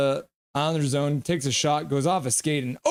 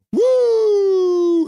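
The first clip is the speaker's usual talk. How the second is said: very high, loud whoop; mock whoop; 9 dB louder than usual. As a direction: whoop like a fan going wild. Acting out a chat cheer.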